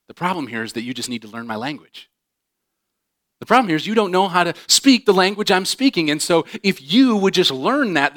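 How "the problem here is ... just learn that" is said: frustrated insistence. Retold or imitated. Voicing frustrated self-centered speaker.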